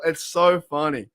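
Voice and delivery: normal talking voice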